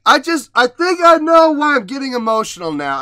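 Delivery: high, loud rush of emotion; really tearing up, pitch jumping up then falling; getting emotional. Real, not acted. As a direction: voice swelling high as tears start. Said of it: "I'm starting to tear up again".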